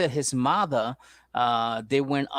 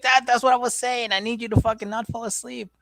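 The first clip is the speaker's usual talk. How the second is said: waking friend; 10 semitones higher than usual. Retold or imitated. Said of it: "They wake up like".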